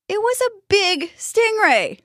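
put-on high, drawn-out boyish voice; defensive boy. A narrator's reading. Boy defending his death by stingray, defensive. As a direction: pipe up slowly in self-defence. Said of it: "the boy added".

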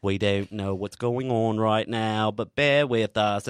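slow, polite mock British captain apology; lightly put-on British accent, apologetic. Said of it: "the captain comes on in his British accent".

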